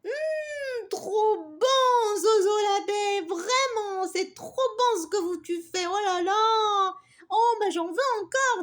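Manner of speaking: put-on high, loud child's mmm of delight. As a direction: savour the honey, thrilled. Les filles goûtent le miel. (fillette ravie, voix aiguë et forte)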